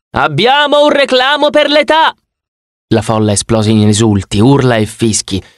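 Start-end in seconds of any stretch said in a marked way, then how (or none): 0.1-2.1 much higher, announced through a megaphone to the whole arcade; megaphone announcement. Acted. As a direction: call it out over the megaphone. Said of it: "annunciò a tutta la sala giochi". (annuncio al megafono, molto più acuto)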